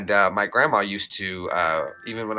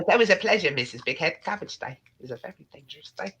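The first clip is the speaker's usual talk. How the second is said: Rocko; 5 semitones higher than usual. Acted. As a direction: play the polite cartoon neighbour. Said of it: "you remember Rocco's Modern Life?".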